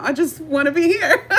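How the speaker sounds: amused giggle under the words; real laughter breaking in; laughing. Real, not acted. Laughing at her own explanation.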